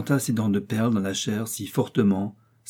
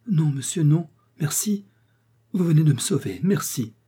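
grateful thanks; 6 semitones higher than usual. Acted. Riri thanks her rescuer.

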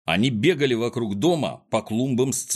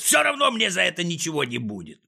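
high-pitched reckless cry; raised, ranting villain voice; spluttering yell; 8 semitones higher than usual. A narrator's reading. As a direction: cry out with furious bravado. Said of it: "орал, брызгая слюной".

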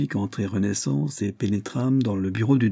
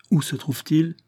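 a touch of panting, higher question; mild gasping urgency from the duke; breathless question; 7 semitones higher than usual. A narrator's reading. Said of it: "demanda-t-il haletant". (question légèrement haletante)

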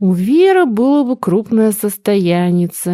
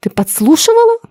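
sharp, much higher angry cry; 14 semitones higher, 4 dB louder than usual. Acted. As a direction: catch the eavesdropper. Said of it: "гневно вскрикнула". (гневно вскрикнув)

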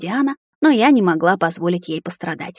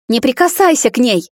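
higher, louder angry cry; fierce raised-voice warning; furious shout; 5 semitones higher, 5 dB louder than usual. A narrator's reading. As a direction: shout to protect someone. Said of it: "яростно закричала Диана".

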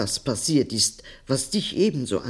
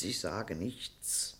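subdued retreat; 5 semitones lower, 14 dB quieter, slower than usual. Acted. Grandmother backs down.

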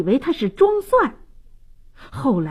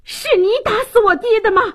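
fast, high-pitched angry demand; urgent raised-voice challenge; shouted accusation; 11 semitones higher, faster than usual. Acted. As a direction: confront a killer at high pitch. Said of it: "叫道".

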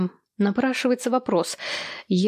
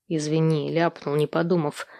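mumble-free but subdued apology, backing off; 4 semitones lower than usual. A narrator's reading. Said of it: "попытался ретироваться Костя". (виновато, пониже, идя на попятную)

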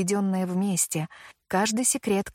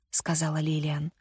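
usual speaking voice

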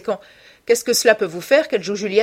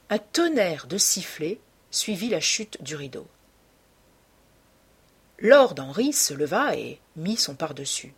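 ordinary delivery

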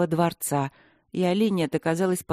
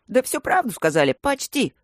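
higher, sheepish excuse; making excuses; 7 semitones higher, 4 dB louder than usual. A narrator's reading. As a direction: wriggle out of blame. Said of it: "оправдывался Аристарх".

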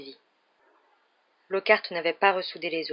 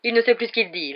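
loud, higher voice for onlookers; ringing announcement; 5 semitones higher, 6 dB louder than usual. A narrator's reading. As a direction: announce it breezily to the crowd. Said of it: "d'une voix claironnante".

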